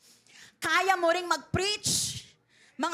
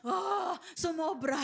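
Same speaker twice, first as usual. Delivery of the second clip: overwhelmed cry. Real, not acted. Overflowing with the Spirit.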